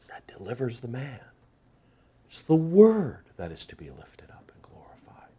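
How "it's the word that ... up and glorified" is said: hushed, breathy whisper. Acted. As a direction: drop it to a secret whisper. Preacher drops to a whisper.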